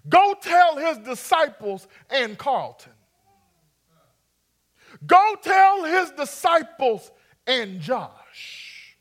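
"Go tell his disciples and Carlton" roared slowly, name by name; preaching at full force. Acted. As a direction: preach it like you really feel it, building. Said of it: "I'm about to call the roll".

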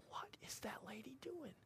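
curious under-the-breath whisper; puzzled whisper. Retold or imitated. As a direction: hush it like a private wonder. Puzzled aside while watching her.

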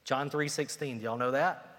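ordinary talk

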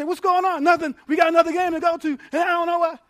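lightly voiced frantic parent; harried parent. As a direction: rattle it off like a worn-out parent. Pastor acts out a harried parent.

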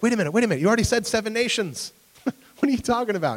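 high, loud, panicked objection; alarmed protest. Retold or imitated. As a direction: splutter in disbelief, laughing. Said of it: "They're like".

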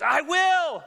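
high, loud joyful declaration; exultant answer; Jesus answering. Retold or imitated. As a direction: proclaim it with delight.

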